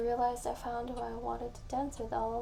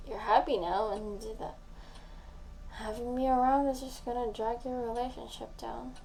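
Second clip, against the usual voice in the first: ordinary delivery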